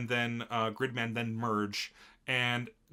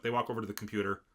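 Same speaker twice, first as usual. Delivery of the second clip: ordinary delivery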